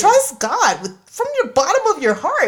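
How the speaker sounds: lightly redone dramatic reading voice; a hint of the stage reading; theatrical reading again. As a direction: echo the overblown scripture voice. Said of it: "You hear it in that voice, too".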